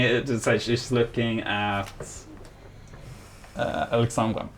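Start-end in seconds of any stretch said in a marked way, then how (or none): none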